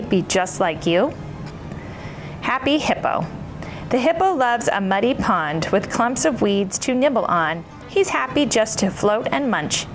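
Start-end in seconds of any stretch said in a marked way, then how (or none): none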